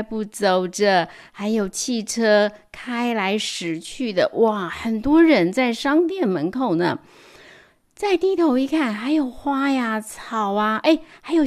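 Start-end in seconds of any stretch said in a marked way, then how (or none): none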